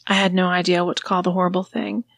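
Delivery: ordinary delivery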